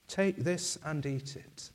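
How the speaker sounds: ordinary talk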